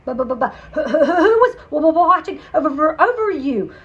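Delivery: loud flustered stammering with laughs; stammering nervous Monty; nervous stutter. Acted. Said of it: "Monty stuttered".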